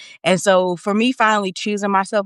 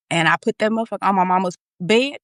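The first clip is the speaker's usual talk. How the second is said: normal talking voice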